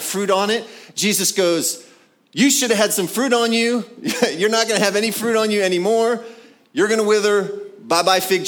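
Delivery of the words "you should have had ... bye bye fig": high, loud telling-off of a tree; playful higher-pitched cursing; mock scolding. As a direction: scold the fig tree in hammed-up indignation. Acts out Jesus cursing fig tree.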